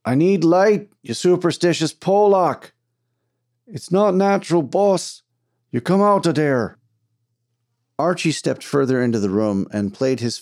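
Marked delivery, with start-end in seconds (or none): 0.0-2.7 high, loud irate holler; angry shouting. Acted. Foreman demanding light from his miner, angry shout. Shouted argument through the tunnel. 3.7-6.8 high, urgent holler back; shouted pleading. Acted. Miner begging his boss to come out, shouting.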